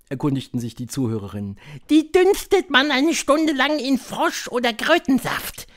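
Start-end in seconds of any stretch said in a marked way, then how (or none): 1.9-5.7 high, loud witch voice; high-pitched, theatrical witch leader; Grand High Witch. A narrator's reading. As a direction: lecture the coven in a high, imperious voice.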